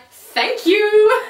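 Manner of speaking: high, loud, drawn-out gratitude; gushing thanks. Retold or imitated. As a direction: act out a swooning fan. Gushing over knight's bare arms.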